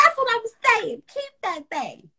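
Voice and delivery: high laughing agreement. Real, not acted. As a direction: squeal it with delight.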